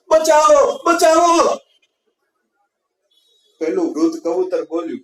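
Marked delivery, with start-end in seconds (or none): 0.1-1.6 much higher and louder cries for help; panicked screams. Acted. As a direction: scream "બચાવો" in terror. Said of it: "માંડ્યા ચીસો પાડવા". (ડરથી મદદ માટે ચીસો)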